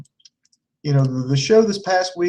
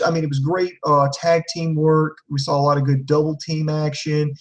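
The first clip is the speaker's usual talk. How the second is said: ordinary talk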